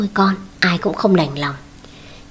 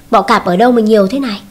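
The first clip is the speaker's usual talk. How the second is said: usual speaking voice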